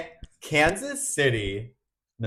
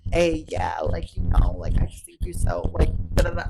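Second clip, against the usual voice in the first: lower, mumbling mock of a try-hard boy; 7 semitones lower than usual.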